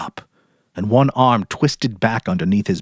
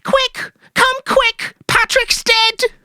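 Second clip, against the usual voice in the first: much higher, panicked shriek; frantic cry; much slower than usual. Acted. Mary crying to the police on the phone, frantic. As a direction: scream for help down the phone. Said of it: "she cried to him".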